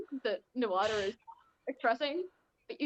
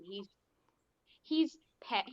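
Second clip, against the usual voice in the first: usual speaking voice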